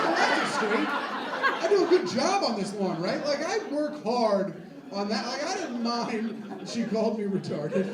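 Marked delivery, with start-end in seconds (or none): 0.0-5.3 loud, high, slow indignant protest. Retold or imitated. Mower answering the snooty lady, loud and offended. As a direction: defend your work, offended. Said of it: "I'm like".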